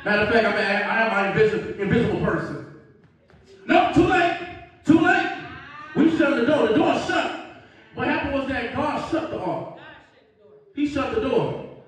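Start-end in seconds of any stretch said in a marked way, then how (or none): 3.7-7.6 higher, firm refusal; high too late. Acted. Noah turning away latecomers, high and firm. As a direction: shut the door on them.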